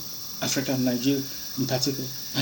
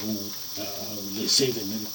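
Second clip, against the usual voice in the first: scornful mock quote; 4 semitones lower, 8 dB quieter than usual. Mocking others' slogan.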